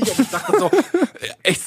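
ordinary talk

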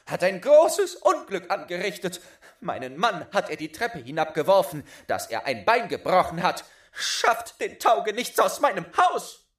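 loud, high, fast angry accusation; furious complaint. Acted. Wife raging at the boy's father. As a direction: demand the good-for-nothing be thrown out.

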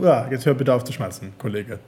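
low, dry mock reprimand; mock telling-off. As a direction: drop low to scold a little one, jokingly.

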